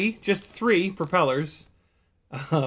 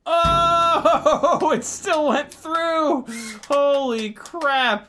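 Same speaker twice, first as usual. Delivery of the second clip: loud, high, laughing disbelief; amazed excitement; 12 semitones higher, 6 dB louder, much slower than usual. Real, not acted. Pilot amazed the drone made it through, loud laughing amazement. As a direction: whoop it out, drawing the words long.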